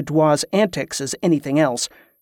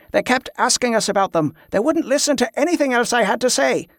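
higher-pitched, exasperated complaint; angry frustration; 8 semitones higher than usual. A narrator's reading. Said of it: "He looked angry again, a frustrated bewilderment".

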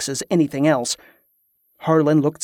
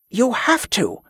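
higher-pitched, urgent plea; almost desperate; 7 semitones higher, slower than usual. A narrator's reading. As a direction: plead with a touch of desperation. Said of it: "sounding almost desperate".

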